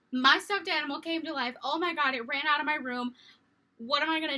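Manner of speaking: loud, high and fast panic; panicked child. Retold or imitated. Scared child bursting into her parents' room. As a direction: blurt it out in terror. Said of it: "And I'm like".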